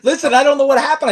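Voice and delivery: quick words through a real laugh; chuckling at the dropped call. Real, not acted. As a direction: laugh it off, a bit flustered. Back after call dropped, laughing.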